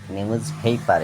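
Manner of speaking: নিউজ পেপার with puffed p, "pheypar"; corrected pronunciation. Shown as a demonstration. Teacher giving pupils the correct form, নিউজ পেপার as "niuj pheypar". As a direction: glide the first vowel of পেপার, "phey". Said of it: "এটা হয় সঠিক উচ্চারণ".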